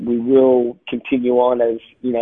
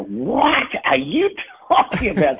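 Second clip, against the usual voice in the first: high, slow, laughing mock confusion; baffled American; 8 semitones higher, slower than usual. Guest mimics Americans baffled by his accent, laughing. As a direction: play the puzzled local, laughing. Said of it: "And people would go".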